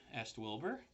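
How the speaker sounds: usual speaking voice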